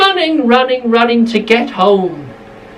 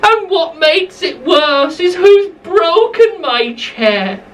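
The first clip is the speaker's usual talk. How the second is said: higher, upset little bear; Baby Bear voice; 6 semitones higher than usual. Acted. Narrator to children, Baby Bear finds his chair broken, higher and upset. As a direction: rise high on the complaint like a hurt cub.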